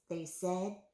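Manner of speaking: usual speaking voice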